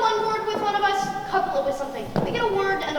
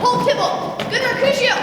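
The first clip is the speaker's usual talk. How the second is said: urgent call; 5 semitones higher, 4 dB louder than usual. Acted. Romeo tries to stop the fight.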